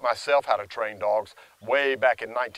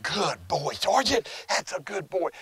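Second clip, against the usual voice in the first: high, affectionate praise for the dog; loving reward voice; 6 semitones higher than usual. Shown as a demonstration. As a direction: praise the dog sweetly, pitched up. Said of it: "when I give him that".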